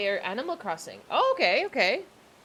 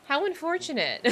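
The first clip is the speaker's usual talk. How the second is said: deadpan sarcasm; 4 semitones higher, 5 dB louder than usual. Retold or imitated. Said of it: "a millennial mindset".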